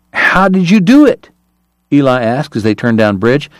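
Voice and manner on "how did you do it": high, incredulous question; astonished question. A narrator's reading. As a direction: ask in amazed disbelief. Astonished Eli demands an explanation.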